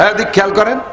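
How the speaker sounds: ordinary delivery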